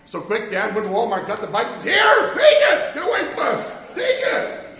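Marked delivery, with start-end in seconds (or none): 1.8-4.7 exasperated shout. Retold or imitated. The dad handing Ian the bike, fed-up high shout. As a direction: shove the bike at him and shoo him off.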